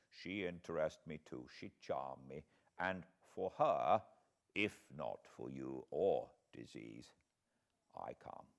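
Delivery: measured and thoughtful, a doctor weighing a case; old foreign professor. A narrator's reading. Professor reporting his examination of Lucy, grave and soft.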